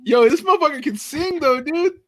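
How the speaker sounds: high, excited exclamation; rising with delight at the singing; hyped up. Real, not acted. Hyped reacting to the singer.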